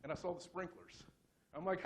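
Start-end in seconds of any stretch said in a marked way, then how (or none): none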